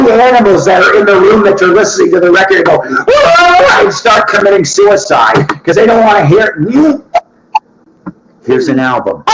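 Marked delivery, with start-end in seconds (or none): 2.8-3.8 high wailing scream of pain; a screaming animal's cry; mock anguished cry. 8.4-9.2 announcer voice. Shown as a demonstration. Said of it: "No, here's an album".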